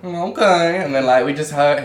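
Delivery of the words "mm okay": higher, louder imitation of her; beach woman's okay. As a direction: mimic her high-pitched okay. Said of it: "She's like".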